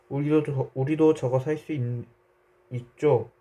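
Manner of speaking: mild, acted child's call; lightly voiced excited cry. A narrator's reading. Boy eagerly asking shopkeeper about buying fish, mildly excited. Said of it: "그러다가 소리쳤다".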